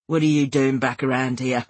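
low, level menacing man's voice; growling man. A narrator's reading. Ralph Gibbs confronting her in the shop, growling. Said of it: "he growled".

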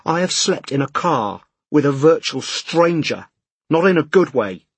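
exasperated rant. A narrator's reading. Man at breaking point to exam official, exasperated rant. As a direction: let pent-up pressure boil over, word by word. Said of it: "a new pressure building inside him".

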